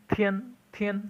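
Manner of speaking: تھین with a breathy aspirated th, as in Urdu تھا; aspirated t sound. Shown as a demonstration. Teacher showing students the aspirated th in تھین. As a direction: say تھین with the th of تھا, not the plain ت of تا. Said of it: "ٹی کی ہم نے تھا کی آواز دینی ہے".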